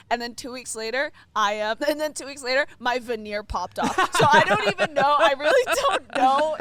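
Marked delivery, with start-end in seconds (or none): 4.2-6.6 laughing it off mid-sentence. Real, not acted. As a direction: laugh through the words. Laughing at her own veneer mishap.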